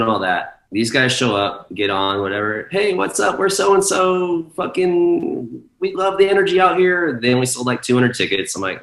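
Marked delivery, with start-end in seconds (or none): 2.7-7.2 much higher, put-on party-bro voice; hype DJ greeting. Visiting DJs hyping up the club crowd, high mock bro voice. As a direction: gush like a hyped-up touring DJ.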